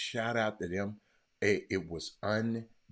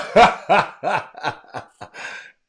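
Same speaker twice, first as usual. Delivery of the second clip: high, loud burst of laughter; big cackling laugh; 10 semitones higher, 14 dB louder than usual. Real, not acted. Laughing after greeting Otha.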